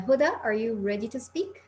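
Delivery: usual speaking voice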